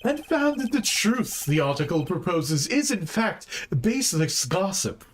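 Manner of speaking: theatrical, lilting announcement; showy, high-starting flamboyant voice. Acted. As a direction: deliver the findings with a flamboyant flourish. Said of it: "let me talk in a flamboyant voice".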